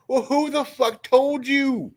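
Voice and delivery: high, slow, disbelieving question; incredulous demand. Real, not acted. Streamer demanding a viewer's source, incredulous. Demands to know who told.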